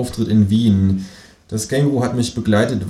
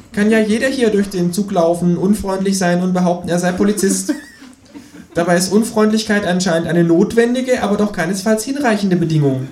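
high, pushy kangaroo voice; a cheeky high voice for the Kangaroo; 12 semitones higher, 4 dB louder than usual. A narrator's reading. Känguru nags the narrator to clean the bath, high and pushy.

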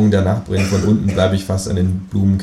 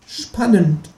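high, sarcastic kangaroo; 13 semitones higher than usual. Acted. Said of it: "sagt das Känguru". (hohe, sarkastische Känguru-Stimme)

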